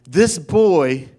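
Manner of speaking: ordinary talk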